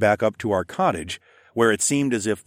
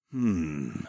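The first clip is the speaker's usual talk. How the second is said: low, soft "hmm"; thoughtful hum; 4 semitones lower, 6 dB quieter than usual. A narrator's reading. Raptor man finishing a letter, low thoughtful hum. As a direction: hum it low while considering. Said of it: "Siskern hummed as he finished reading".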